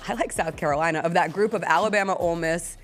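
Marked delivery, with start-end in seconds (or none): none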